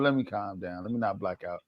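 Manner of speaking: quiet. Retold or imitated. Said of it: "So I was just like".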